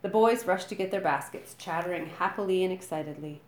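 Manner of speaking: ordinary delivery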